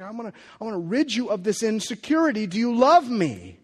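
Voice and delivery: Jesus begging Peter to say it, full force; impassioned plea. Acted. Jesus pleading, voiced at peak.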